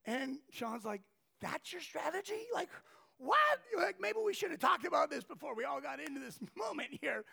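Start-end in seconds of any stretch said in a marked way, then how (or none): 1.4-7.2 higher, louder, laughing disbelief; can't believe what she just said; incredulous disbelief. Retold or imitated. Sean stunned by her strategy.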